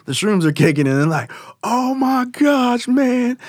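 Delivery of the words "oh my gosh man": high, drawn-out awestruck stoner; stretched-out mock amazement; tripping friend. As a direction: imitate someone blown away by shrooms, slow and high. Voicing friends as shrooms kick in.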